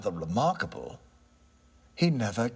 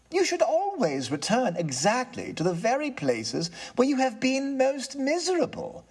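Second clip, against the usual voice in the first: higher, composed gentleman; polite, regaining his nerve; lightly voiced tenant; 7 semitones higher than usual. Tenant answers the ghost, calm and courteous.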